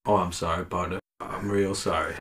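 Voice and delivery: put-on hushed, sheepish cowboy accent; mock cowboy apology. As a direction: quietly play along as a sorry American cowpoke. Plays along with cowboy accent.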